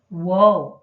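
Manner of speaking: a loud, startled whoa; surprised whoa. Shown as a demonstration. Teacher demonstrating an exclamation to students, startled loud whoa. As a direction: blurt it out like you just noticed.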